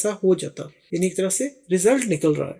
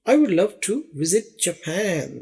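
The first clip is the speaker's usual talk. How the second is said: slow example read; much slower than usual. Shown as a demonstration. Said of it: "ایک اور مثال دیکھیے".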